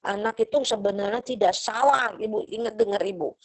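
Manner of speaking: ordinary delivery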